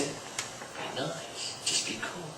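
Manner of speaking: soft whispered advice; hushed cool-headed reminder; Patrick Swayze quote. Retold or imitated. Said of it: "What did Patrick Swayze say?".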